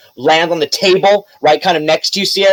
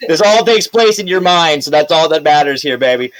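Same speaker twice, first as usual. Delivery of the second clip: ordinary delivery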